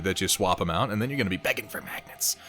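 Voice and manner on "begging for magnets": quick, hushed whisper; sudden drop into a soft whisper; teasing whisper. Pretend. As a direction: whisper it like a mock warning. Teasing warning dropped into whisper.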